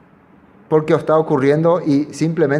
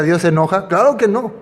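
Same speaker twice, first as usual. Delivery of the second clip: ordinary delivery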